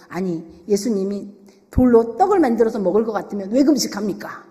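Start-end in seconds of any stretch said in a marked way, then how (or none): none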